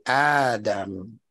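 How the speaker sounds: "Adam" with the first vowel drawn out long, aaa-dam; slow "Adam" with a long first a; stretched Adam. Shown as a demonstration. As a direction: stretch the opening a of "Adam" as the scholars do. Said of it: "Medda means I can stretch that aleph out".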